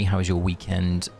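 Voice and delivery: normal talking voice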